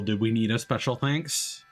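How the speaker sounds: ordinary talk, nothing marked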